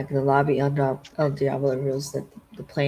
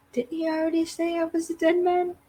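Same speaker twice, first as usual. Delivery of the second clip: bratty, much higher and mocking; sassy, pitched way up, teasing; full on sass. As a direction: toss it off like a sassy brat. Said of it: "Full on sass".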